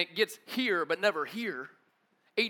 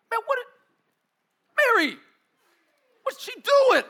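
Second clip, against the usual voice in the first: much higher, louder put-on voice; indignant Martha; 11 dB louder, slower than usual. Martha catching Mary at Jesus' feet, high and indignant. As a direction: squeal it out, outraged at Mary.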